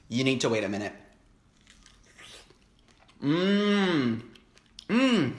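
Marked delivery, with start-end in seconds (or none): 3.2-5.3 high pleased mmm; delighted hum. Real, not acted. Tasting the sweet strawberry, delighted humming.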